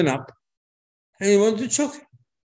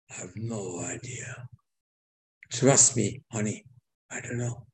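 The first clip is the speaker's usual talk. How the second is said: much softer, lower, coaxing wife; soft coy reply; 4 semitones lower, 6 dB quieter than usual. Acted. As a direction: murmur it sweetly to the king, honey. Esther answering the king softly.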